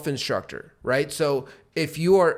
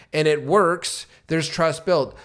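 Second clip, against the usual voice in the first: ordinary talk, nothing marked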